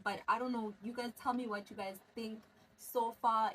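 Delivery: normal talking voice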